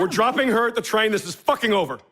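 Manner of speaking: furious guest raising his voice at the host; angry outburst. Acted. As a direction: snap back in anger, pitch jumping up. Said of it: "Adjust your tone".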